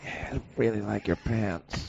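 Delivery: soft, breathy creepy whisper; creepy stranger whispering a compliment; creepy whisperer. Pretend. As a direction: lean in and creep them out softly. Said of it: "the whisperer who's creepy".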